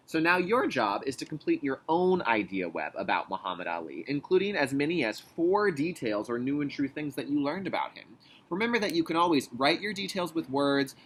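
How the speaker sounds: ordinary delivery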